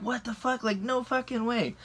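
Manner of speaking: high-pitched retold disbelief; incredulous. Driver reacting to robbery accusation, incredulous.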